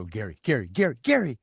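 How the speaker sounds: mock nagging call, rising sharply in pitch; wife calling out. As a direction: call out a name with growing insistence. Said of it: "she's going, she'll go".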